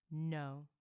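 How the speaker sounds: a firm, final answer to the king. Acted. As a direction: plant the no like a stone. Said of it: "the answer came in a firm voice".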